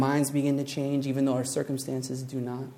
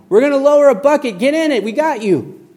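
much higher, much louder, fast shout from a helicopter; 11 semitones higher, 11 dB louder than usual. Acted. Helicopter crew shouts down to man, loud fast yell. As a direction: yell over the rotor noise. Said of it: "a guy shouts down".

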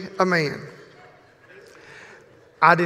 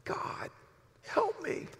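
softer, breathy plea; stage whisper; 10 dB quieter, slower than usual. Acted. Exhausted Ben begging God, stage whisper. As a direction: pray it in a stage whisper, pleading. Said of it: "he cries out in, in a stage whisper".

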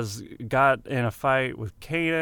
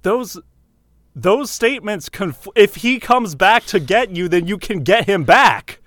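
louder, higher insistent argument; raised voice; 7 semitones higher, 7 dB louder than usual. Acted. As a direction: push the point hard, raising your voice. Character argues loudly with Kanan.

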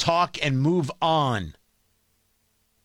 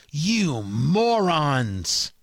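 very slow, drawn-out scorn; exasperated; 4 semitones lower, much slower than usual. Real, not acted. Radio host scolding quarreling Republicans, slow exasperated scorn. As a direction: drag out morons in disbelief.

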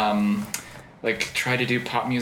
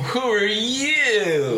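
mock surprise; 9 semitones higher, much slower than usual. Host reacts to surprise guest.

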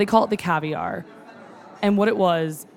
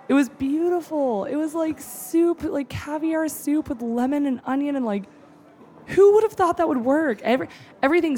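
moved; 8 semitones higher than usual. Real, not acted. Recalling the dish that made her cry, choked up. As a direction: let emotion catch in the throat. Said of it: "And I fucking cried".

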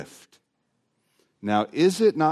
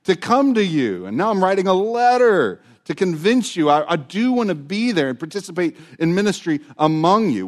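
higher and louder, pleading his case to the Romans; emphatic Paul; 6 semitones higher, 4 dB louder than usual. Retold or imitated. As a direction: voice Paul insisting he truly wants to come. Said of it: "He's so emphatic".

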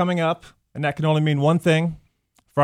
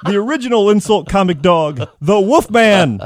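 ordinary delivery

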